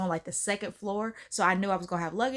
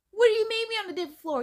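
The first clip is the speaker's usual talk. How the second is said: much higher, fast disbelieving question; incredulous; 12 semitones higher, much faster than usual. Retold or imitated. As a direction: fire it back in shock. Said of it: "She's like".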